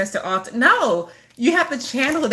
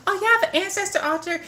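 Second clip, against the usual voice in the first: quoting a friend; 11 semitones higher than usual. Retold or imitated. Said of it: "'cause she's like".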